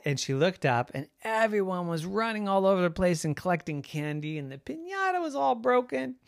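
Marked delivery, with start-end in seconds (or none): none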